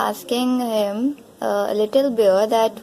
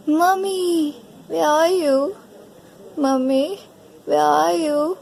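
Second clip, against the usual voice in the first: mock crying call; 5 semitones higher than usual. Lost bear looking for his mommy, mock crying call. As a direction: call out like a lost little one. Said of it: "now Bruno is crying".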